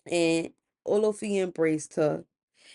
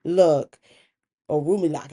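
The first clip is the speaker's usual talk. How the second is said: ordinary delivery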